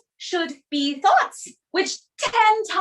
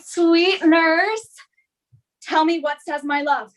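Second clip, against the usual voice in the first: ordinary talk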